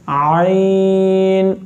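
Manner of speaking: आइन held very long from the middle of the throat; throat letter ain. Shown as a demonstration. Quran teacher to students, modelling ain, slow and held. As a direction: draw out आइन slowly from the mid-throat. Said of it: "ये हलक के दरमियान वाले हिस्से से अदा होता है".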